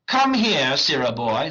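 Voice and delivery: loud, high theatrical summons; calling out. Acted. Wagner summoning the clown, calling out loudly. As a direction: call the servant over imperiously.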